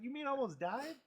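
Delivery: high, incredulous retold question; mock alarm. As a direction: act out the sudden realization of danger. Retells shock at granddad's near miss.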